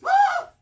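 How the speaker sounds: shrill terrified scream, very high and loud. Acted. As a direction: scream in terror at the monster. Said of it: "He goes".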